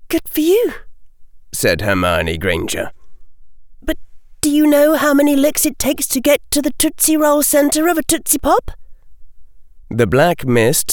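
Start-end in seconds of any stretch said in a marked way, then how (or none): 0.1-0.7 put-on high girlish voice for Hermione; much higher girl voice, demanding; young girl's voice. 3.8-8.7 brisk, chirpy schoolgirl, fast; high quick girl voice. Acted. Hermione voiced.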